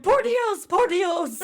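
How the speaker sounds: high, loud excited Spanish. Retold or imitated. Costa Rica girls teaching Spanish, high and gushing. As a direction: gush it like thrilled fans. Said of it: "they're like".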